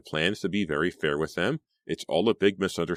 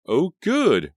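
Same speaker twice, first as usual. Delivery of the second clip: much higher and louder, overjoyed burst; gushing; 12 semitones higher, 8 dB louder than usual. A narrator's reading. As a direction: gush with delight at the good news. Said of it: "Riva gushed".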